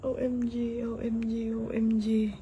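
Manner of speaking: breathless whispered disbelief; awed whisper. Real, not acted. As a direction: whisper it in awe. Stunned, hushed disbelief.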